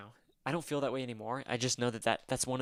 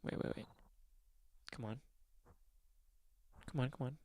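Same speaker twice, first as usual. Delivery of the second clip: quiet urging; 13 dB quieter than usual. Real, not acted.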